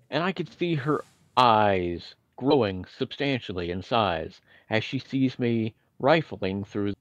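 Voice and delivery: ordinary talk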